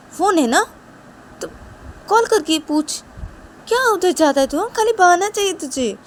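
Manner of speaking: loud, high, rising scold; scolding friend. Acted. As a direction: order him to sit down. Vikas scolding Rahul.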